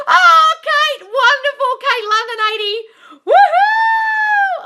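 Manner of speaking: high, loud squeals of delight with a laugh; excited cheering. Real, not acted. Cheering a viewer's news about her person, excited. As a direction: shriek with joy for her.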